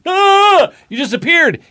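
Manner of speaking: high, loud startled cry; startled friends. Acted. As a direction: jump at the sudden vanishing.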